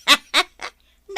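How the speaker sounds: amused laugh. Acted. Amused reply to the uncle.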